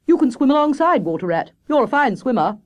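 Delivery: high, loud, carefree reply; breezy hare character; Hare, breezy and confident. Acted. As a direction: brush it off loudly, pitched high.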